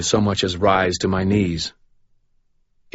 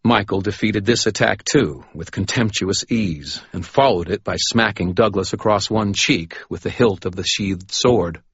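ordinary delivery

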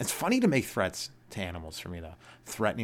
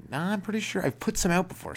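higher, eager store clerk; high confident clerk voice; 10 semitones higher than usual. Retold or imitated. Clerk insisting to the customer, higher and eager. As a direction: play the sure-of-himself clerk, pitched up. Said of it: "He's like".

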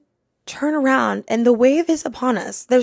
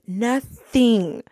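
ordinary talk

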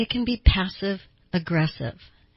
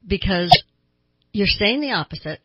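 usual speaking voice

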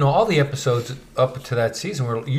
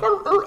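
mock dog barks. Diamond Dogs barking at Roy.

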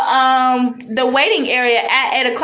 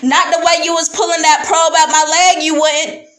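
fast, rising, higher heated retort; heated comeback; 6 semitones higher than usual. Retold or imitated. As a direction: fire it back fast and indignant. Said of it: "he was like".

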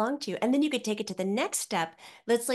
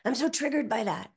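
mocking quote; 4 semitones higher than usual. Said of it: "other people like to call it the trigger".

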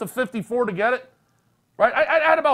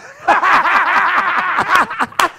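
big loud cackling laugh; laughing hard after the Loserville line; loud laugh burst; 9 semitones higher, 8 dB louder than usual. Real, not acted. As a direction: roar with laughter at the joke. Laughing at own comeback.

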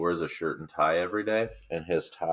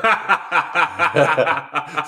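loud real laugh; 12 semitones higher, 11 dB louder than usual. Real, not acted. Laughing at his own Columbus Day story, loud cackle. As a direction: burst out laughing, high and loud.

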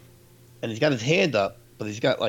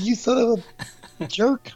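put-on much higher, louder kid-style indignant yell; mock outrage; 14 semitones higher, 5 dB louder than usual. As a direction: fling the insult in half-laughing fury. Retold angry reaction to prank.

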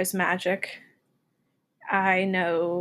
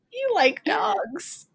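put-on childish third grader voice; 11 semitones higher, 6 dB louder than usual. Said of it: "written by, like, third graders".

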